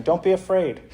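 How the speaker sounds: a hint of a looming angel calming a person; meme angel. Meme angel tells a terrified person, lightly voiced angel. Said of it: "and they're saying".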